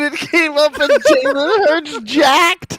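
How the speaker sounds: high, loud, drawn-out laughing shout; cracking up. Real, not acted. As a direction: squeal it out through helpless laughter. Cracking up over a search result.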